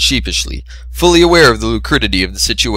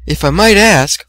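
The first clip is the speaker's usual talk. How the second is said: higher gentle shy voice; timid Fluttershy; 9 semitones higher, 3 dB louder than usual. A narrator's reading. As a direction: explain shyly, pitched up.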